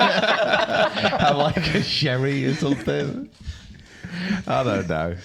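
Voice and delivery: put-on laughing, posh old school Pete. Co-host inventing posh old school Pete, laughing through a slow, high voice. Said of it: "old school Pete".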